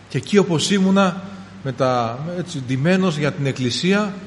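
normal talking voice

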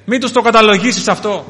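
imitating a martyr's loud prayer; acted, impassioned plea; crying out. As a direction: call out to heaven, high and loud. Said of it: "Και φώναζε και έλεγε".

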